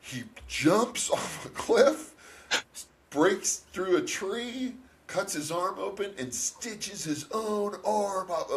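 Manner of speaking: high, drawn-out amazement; dad's excited retelling. Retold or imitated. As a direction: retell it like an astonished dad, hitting each stunt hard. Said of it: "I remember my dad coming through the door like".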